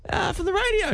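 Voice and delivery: very high, timid squeak; squeaky nervous voice. Retold or imitated. Host calling a film star's hotel room, high nervous squeak. Retelling his nervous call to star.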